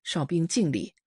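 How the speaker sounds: usual speaking voice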